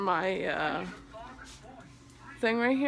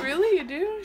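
surprised; 8 semitones higher than usual. Retold or imitated. Mock surprised reply to daughter.

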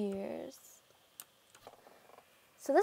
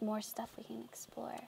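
hushed whisper; 10 dB quieter than usual. Real, not acted. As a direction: whisper it softly. Whispering in stronghold.

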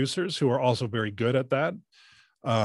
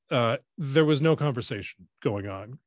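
ordinary delivery

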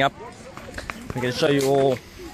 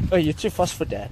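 normal talking voice

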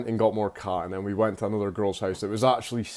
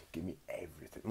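barely audible, menacing whisper; whispered threat; 4 semitones lower, 16 dB quieter, faster than usual. Retold or imitated. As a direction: whisper it like a robber avoiding being overheard. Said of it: "low in his voice so no one would hear him".

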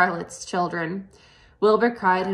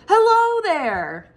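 high, slow, louder pig voice; Wilbur's greeting; 11 semitones higher, 7 dB louder, much slower than usual. A narrator's reading. Wilbur greets the tiny spiders, high and slow. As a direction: sing out a hushed hello. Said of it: "he said".

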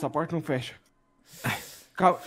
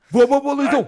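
high, loud burst of praise; excited cheer; 9 semitones higher, 9 dB louder than usual. Real, not acted. Gamer cheering Luizão's shot, loud and excited. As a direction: cheer your teammate on.

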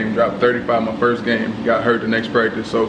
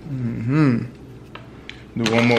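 ordinary delivery

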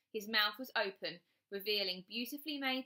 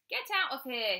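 loud, higher-pitched angry snap; snapping; 6 semitones higher, 6 dB louder than usual. A narrator's reading. Bradley to his sister barging in, snapping. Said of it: "he snapped at her".

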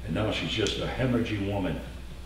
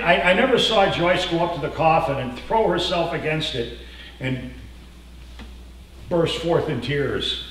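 ordinary talk

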